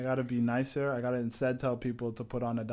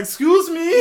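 high, loud indignant shriek; shocked and offended; indignant excuse me; 15 dB louder, slower than usual. Retold or imitated. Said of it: "all of his mans were like".

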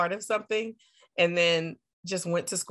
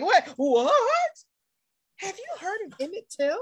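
mock clueless; 12 semitones higher than usual.

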